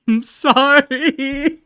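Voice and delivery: slow, loud, high sorry through laughter; laughing sorry. Real, not acted. Streamer apologizing to chat, slow and laughing. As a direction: drag out the apology while cracking up.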